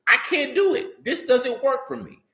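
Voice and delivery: high, strained protest; David protesting. Retold or imitated. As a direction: push the armor away, exasperated. David rejecting the king's armor.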